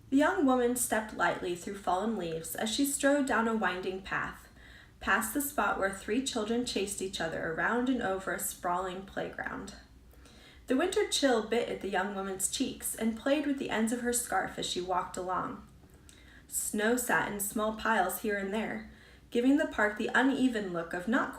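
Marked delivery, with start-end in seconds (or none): none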